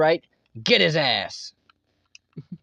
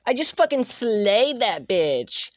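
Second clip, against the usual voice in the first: put-on slow, sassy Starbucks girl, queen up high; Starbucks girl impression; 3 semitones higher, much slower than usual. Showing off his Starbucks girl impression after a kill. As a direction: brag like a sassy barista. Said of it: "voice impression of specifically a fucking Starbucks girl".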